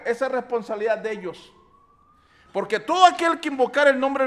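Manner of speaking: loud, emphatic pulpit delivery; preaching at full force. Acted. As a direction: hammer home the responsibility. Preaching to the congregation.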